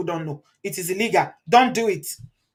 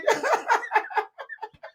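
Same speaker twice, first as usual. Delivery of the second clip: laughing hard at a viewer's story; high burst of laughter; 13 semitones higher than usual. Real, not acted. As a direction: break into helpless laughter.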